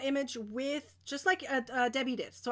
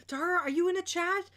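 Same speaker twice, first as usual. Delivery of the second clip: ordinary talk, nothing marked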